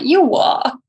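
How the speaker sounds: high-pitched baffled retort; mock disbelief. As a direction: imitate a taken-aback partner. Retells fiance's reaction to being called mate.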